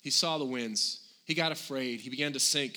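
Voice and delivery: normal talking voice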